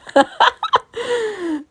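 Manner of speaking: a bright burst of high laughter. Real, not acted. Laughing to her viewers as the rain shows, a high real laugh.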